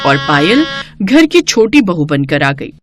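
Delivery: plain reading voice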